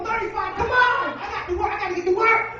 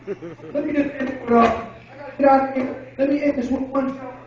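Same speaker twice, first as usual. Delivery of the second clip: ordinary talk, nothing marked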